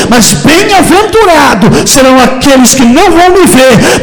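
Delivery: loud, impassioned Pentecostal preaching, slowing and dropping at the end; pregação fervorosa. Real, not acted. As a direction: proclaim it to the congregation with stretched key words. Preaches at full force. (pregação pentecostal inflamada)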